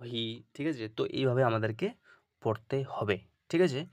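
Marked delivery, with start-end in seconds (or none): none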